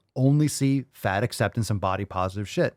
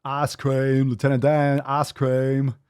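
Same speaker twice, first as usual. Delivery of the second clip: mock movie line; 3 semitones higher, 4 dB louder, slower than usual. Parodying the Forrest Gump clip.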